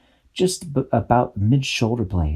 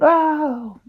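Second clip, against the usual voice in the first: fairy cry of pain. A narrator's reading. Little fairy knocked out of the air, crying out. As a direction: yelp as she's smacked away. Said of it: "Dashy went".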